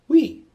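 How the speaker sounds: "We" shouted high and loud, like queen. Shown as a demonstration. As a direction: belt out "We" as in queen. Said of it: "like the U E in queen".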